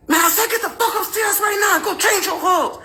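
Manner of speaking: high, strained angry order; mock shout squeezed through gritted teeth; teeth-gritted yell. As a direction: imitate a furious husband repeating himself. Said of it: "Yelled, and like how you grit your teeth".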